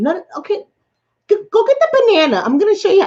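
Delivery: very high, excited overriding order; insistent mom act. Retold or imitated. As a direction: bulldoze the protest in a squeaky, eager mom voice. Acts out mom insisting on demonstration.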